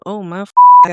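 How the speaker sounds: loud burst of shock; a sudden loud gasp of disbelief; startled exclaim. Retold or imitated. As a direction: blurt it out, shocked. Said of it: "I said".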